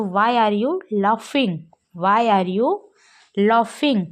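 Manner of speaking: slow, clear question for learners; drilled English question; slow teaching model. Shown as a demonstration. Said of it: "तो".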